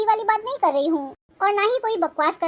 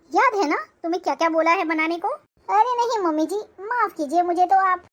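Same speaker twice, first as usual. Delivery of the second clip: ordinary talk, nothing marked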